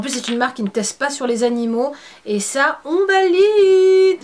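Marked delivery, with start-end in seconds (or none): 2.8-4.2 excited. Acted. Enthusiastic approval.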